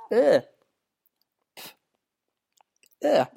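disgusted groan. Real, not acted. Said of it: "I just swallowed a fly".

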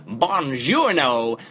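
put-on slow, drawn-out mangled Italian accent; badly faked Italian with heavy American vowels; Brad Pitt's bad Italian. Reviewer quoting the Inglourious Basterds scene, bad Italian accent. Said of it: "and then Brad Pitt goes".

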